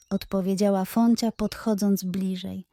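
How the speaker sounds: ordinary delivery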